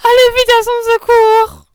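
high, loud, stretched-out plea; desperate child's wail; distraught cry. A narrator's reading. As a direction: beg for help at full voice. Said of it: "sanglotait Marguerite, désolée".

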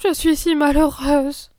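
voix plus aiguë et forte, lente, au bord des larmes; en fondant en larmes. Acted. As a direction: laisser éclater un sanglot retenu. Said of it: "ajouta-t-elle en fondant en larmes". (fondre en larmes)

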